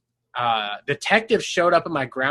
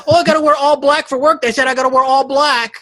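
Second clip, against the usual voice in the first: high, louder whiny mock voice; mocking friend; 9 semitones higher, 6 dB louder than usual. Mocking friend about his kitchen job, high whiny mock voice. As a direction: parody him whining about work.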